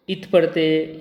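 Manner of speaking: usual speaking voice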